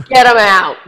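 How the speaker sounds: hammed-up furious dad; mock outraged yell. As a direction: throw him out with a shout. Father ordering him out.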